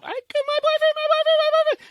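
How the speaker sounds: very high, squealed mimicry; imitated clingy girlfriend. Imitating the girl showing off her boyfriend, clingy excitement. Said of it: "The girl's a little".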